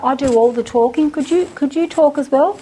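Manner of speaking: ordinary talk, nothing marked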